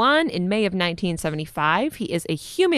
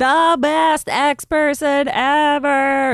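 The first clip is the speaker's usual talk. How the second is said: excited; 6 semitones higher, 4 dB louder, much slower than usual. Real, not acted. Gushing fan praise.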